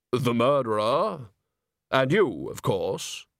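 slow, dry Death voice; bass voice. Acted. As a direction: speak as unhurried Death. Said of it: "you do have a very good bass voice".

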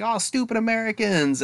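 high-pitched mock scorn; mocking villain. Imitating a TV wrestling villain jeering at the Challenger, mocking. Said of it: "she's, like, mocking the Challenger".

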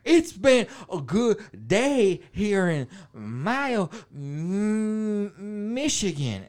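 loud, high, halting drawl; hammed-up slow rural woman; slow-talking sister. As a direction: labor over each word, sticking on "Michigan". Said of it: "his sister was like".

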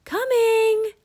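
high, loud answering call; long, high-pitched shout. A narrator's reading. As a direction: holler back to mom. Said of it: "Annie shouted again".

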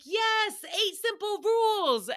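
high, eager shout-in; mock excited answer; excited yes. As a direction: jump in proudly. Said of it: "I was like".

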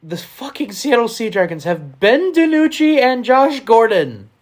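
loud, slow, high excited outburst. Real, not acted. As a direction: hype it up loudly and slowly. Excited outburst about players.

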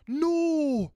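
put-on high, loud, stretched English-accent wail; mock Melanie accent. Friend who hates the accent, loud high mock-English cry. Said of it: "both Melanie's accent is just".

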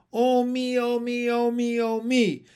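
high, loud sing-song wail; wailing lament. Acted. Frog wife laments her curiosity, wailing. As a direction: wail it over and over on one high note.

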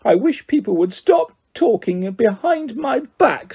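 high, tearful whine; choked with tears. A narrator's reading. Myrtle to the whisperers, choked with tears. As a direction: act wounded, close to tears. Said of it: "in a voice choked with tears".

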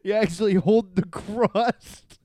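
high, loud, slow and giggling; laughing quote. Retold or imitated. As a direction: crack up while saying it. Quoting caller, laughing.

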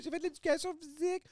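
put-on high little kid voice; child voice. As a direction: answer like a small child. Voicing a child answering.

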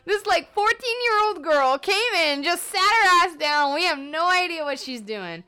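baffled receptionist. Retold or imitated. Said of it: "the lady at the front desk is like".

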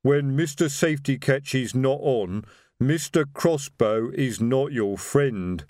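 very slow troll recitation, word by word; troll reciting. Acted. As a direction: drone the safety rule like a drilled troll. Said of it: "recited Detritus".

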